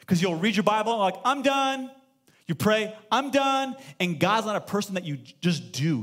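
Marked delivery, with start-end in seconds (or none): 1.2-1.9 lightly voiced bored churchgoer, higher; mock done voice. Pastor imitating a churchgoer, mock bored. Mock quote after Bible reading. 3.1-3.8 lightly voiced bored churchgoer, higher; mock done voice. Pastor imitating a churchgoer, mock bored. Mock quote after praying.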